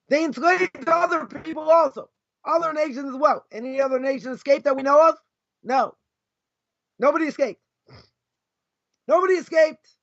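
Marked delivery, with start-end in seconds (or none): none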